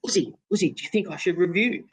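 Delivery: high, pleading question to a silent partner; anxious plea to Uzi, pitched high; pleading mate. Retold or imitated. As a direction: beg your batting partner for an answer. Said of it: "you know, like".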